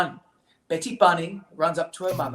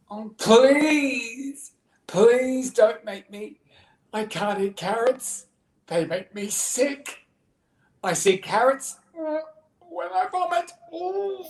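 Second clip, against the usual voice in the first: high, slow, whiny begging; hammed-up little bunny despair; pleading child; 8 semitones higher, 4 dB louder, much slower than usual. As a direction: beg melodramatically, climbing to a queasy wail. Betty begs her brother, feigning sickness.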